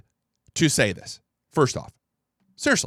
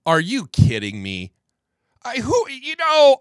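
incredulous; 8 semitones higher, 4 dB louder than usual. Real, not acted. Reacting to played clip.

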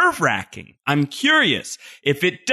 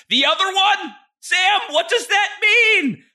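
mock high voice. Host mocks his own question to the creator in a squeaky high voice. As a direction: play it up in a silly high pitch.

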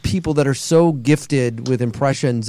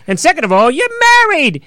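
much higher put-on character voice, louder; movie impression; 12 semitones higher, 3 dB louder than usual. Panelist mimicking a movie line for fellow actors, high character voice. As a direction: pop the line like the film star. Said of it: "I watched Forget About Paris".

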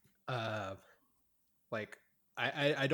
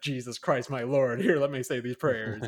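loud, slower fretful churchgoer; mock pious panic; 8 dB louder than usual. Devout family fearing they upset God, mock fretful. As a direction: ham up a scared religious believer. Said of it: "everything they do is just like".

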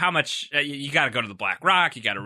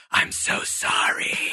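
slow breathy whispered apology; slow whispered quote of Rousseau; quoting Rousseau's whisper; slower than usual. Retold or imitated. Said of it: "one of which being Rousseau's".

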